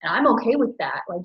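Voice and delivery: ordinary talk, nothing marked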